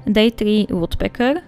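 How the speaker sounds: ordinary talk, nothing marked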